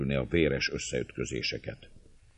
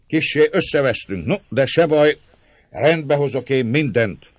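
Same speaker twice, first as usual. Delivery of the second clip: derűs, magas, hangos békítés; csitítsd őket jókedvűen; 11 semitones higher, 10 dB louder than usual. A narrator's reading. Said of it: "szólt jókedvűen". (vidáman, jó hangosan)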